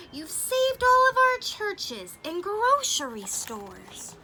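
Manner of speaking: a hint of a tiny grateful mayor; tiny mayor's voice. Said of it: "came the voice".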